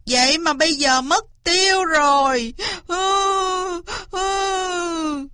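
high-pitched, hammed-up sobbing complaint; bawling. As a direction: wail your story between sobs. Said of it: "Cáo vừa khóc bù lu bù loa vừa kể".

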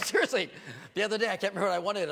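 ordinary talk

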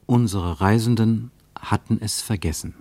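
normal talking voice

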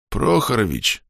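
a weak, rasping call; hoarse croak. Acted. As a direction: croak the name, dying. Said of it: "прохрипел дембель".

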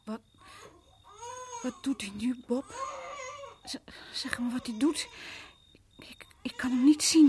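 weak, stammering fear; barely able to ask; faint frightened voice. Acted. Angstige vrouw na flauwte. (zwak en angstig)

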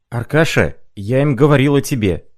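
loud excited call; sudden shout. A narrator's reading. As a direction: burst out as if just remembering. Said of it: "закричал он вдруг".

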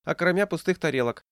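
put-on high-pitched old woman's quick grumble; rapid patter. A narrator's reading. Old woman answers the accusing diner, rapid patter. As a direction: toss it off quickly, high and defensive. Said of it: "скороговоркой ответила старушка".

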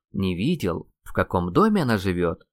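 usual speaking voice

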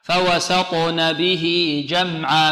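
فوسطن with a full vowel on each letter, very slow. Shown as a demonstration. A teacher modelling full vowels, slowly. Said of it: "بل حركة مشبعة".

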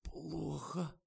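high, faint, strained voice; barely squeezed out. A narrator's reading. Tasya sways clutching her throat, barely getting it out. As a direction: force it out with the last strength. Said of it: "выдавила она еле-еле".

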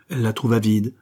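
ordinary delivery

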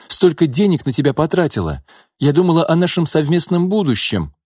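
reproachful woman. A narrator's reading. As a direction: pour out the reproaches. Nina reproaches Gordey.

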